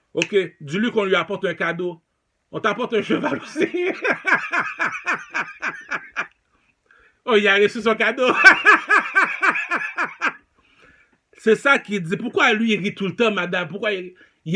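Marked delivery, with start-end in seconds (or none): 3.7-6.2 high laugh. Real, not acted. Laughing at the horse gift joke. 8.3-10.3 loud high burst of laughter. Real, not acted. Laughing after the gift line, loud high laugh.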